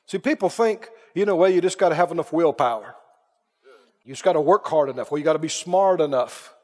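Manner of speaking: ordinary talk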